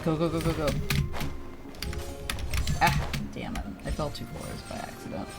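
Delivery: usual speaking voice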